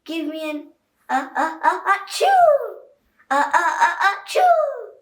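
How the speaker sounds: comic sneezing cheer; sneezing through the cheer. A narrator's reading. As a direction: fight the sneeze then let it burst. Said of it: "cheerleaders sneezed".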